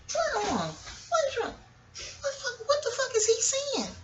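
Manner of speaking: very high, hushed little voice; baffled pet talk. Real, not acted. Owner wondering what spooked Leo is saying, high soft bafflement. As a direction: puzzle aloud, soft and squeaky. Really coaxing, puzzling over pet.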